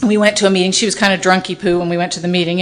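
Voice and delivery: ordinary talk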